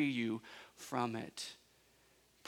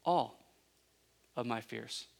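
normal talking voice

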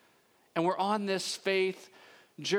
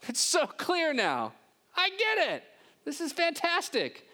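much higher, gushing, hammed-up delight; mock elation; 11 semitones higher than usual. What Mary does not say, mock gushing delight. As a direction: overplay a too-easy delight. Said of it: "she's not saying".

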